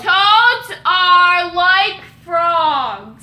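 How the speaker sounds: slow, loud enunciation; loud, deliberate repeat. Real, not acted. Player repeating for a partner who only hears frogs, loud and slow. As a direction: project each word to get it across.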